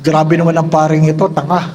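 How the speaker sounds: imagined mocking remark. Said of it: "Baka sabihin".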